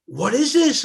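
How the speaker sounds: high, loud startled question; a small boy suddenly asking what this is; puzzled child asking. Retold or imitated. Child at a friend's hallway, startled and loud. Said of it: "I said".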